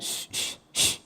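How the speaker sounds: sharp hushing "shh"; shushing. Acted. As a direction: hush a child with repeated shh. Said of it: "Kita bilang".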